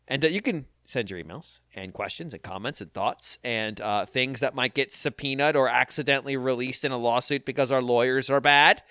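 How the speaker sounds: ordinary delivery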